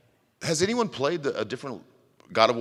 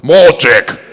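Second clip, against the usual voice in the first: very loud, higher Russian shout; comic bellow; 4 semitones higher, 16 dB louder than usual. Pretend. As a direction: bark it out for the punchline. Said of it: "may I speak to President Trump?".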